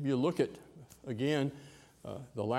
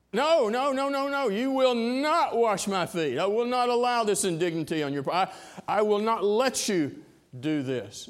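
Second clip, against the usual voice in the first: emphatic refusal; 8 semitones higher, 6 dB louder than usual. Acted. Peter refuses Jesus.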